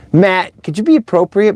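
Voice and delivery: ordinary talk, nothing marked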